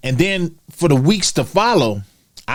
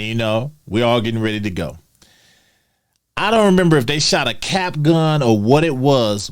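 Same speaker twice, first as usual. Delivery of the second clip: ordinary talk, nothing marked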